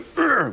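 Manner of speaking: high loud grunt; a masked wrestler's grunt. Retold or imitated. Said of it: "all he had to do was just go".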